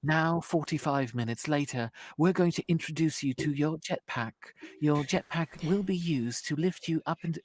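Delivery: patiently walk a player through controls; mock chirpy tutorial narrator; game tutorial guide. Mimics a tedious game tutorial guide.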